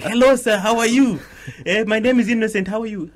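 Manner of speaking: high, loud, very proper greeting; eager newcomer speaking careful English; overly polite formal voice. Retold or imitated. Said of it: "I was like".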